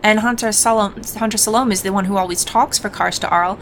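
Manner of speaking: normal talking voice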